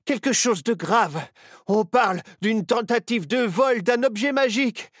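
high, loud, breathless alarm; urgent panting report. Acted. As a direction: rush in with urgent news. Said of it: "arriva en courant".

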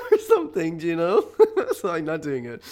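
high, loud laughing protest; cracking up and abandoning the bit; laughing giving up. Real, not acted. Giving up the accent to her listeners, laughing. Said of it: "I'm not doing the accent".

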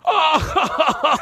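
high amused "Oh" breaking into a laugh; high retold chuckle; laughing stepdad. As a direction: laugh it off like the news is funny. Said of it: "my stepdad looks at me and goes".

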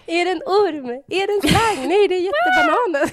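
high, hammed-up announcer voice, laughing; mock superhero announcer. Joking about naked man, high mock announcer reveal. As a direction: build it like a joke cartoon reveal.

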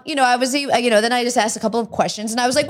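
ordinary talk, nothing marked

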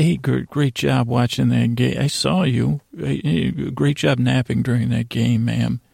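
ordinary talk, nothing marked

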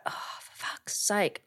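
slow, whispered curse; exasperated whisper. Retold or imitated. Retelling her dismay at the hacked Netflix account, exasperated whisper.

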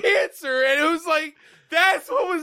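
high, laughing disbelief. Real, not acted. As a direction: crack up mid sentence.

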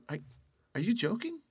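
high, disbelieving question; incredulous cousin. Retold or imitated. Cousin reacting to the bar job, high and incredulous. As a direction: pitch it way up in disbelief.

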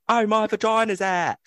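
high-pitched mock southern English accent; imitating a woman with a southern accent. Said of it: "in, like, a southern accent".

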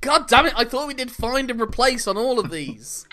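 jokey frustrated yell; exasperated outburst. Acted. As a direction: blow up at your own typo. Host annoyed at own script typo.

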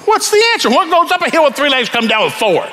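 high, squeaky, exasperated plea; exasperated demand. Retold or imitated. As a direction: squeal it out, fed up. Businessman repeats demand.

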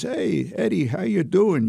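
put-on Brooklyn accent. Old priest friend phoning Eddie out of the blue, in a Brooklyn accent. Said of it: "he has a real Brooklyn accent".

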